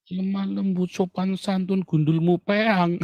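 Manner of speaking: high mock-sweet voice slowing into a jeer; mocking gentleness. Preacher throwing the critics' demand back, mocking. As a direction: parrot the polite words, then scoff.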